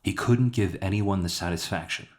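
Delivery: ordinary delivery, nothing marked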